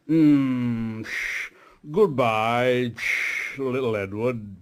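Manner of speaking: a touch of a boastful big engine voice; lightly voiced, puffed-up show-off; proud big engine. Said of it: "He was very big and very proud".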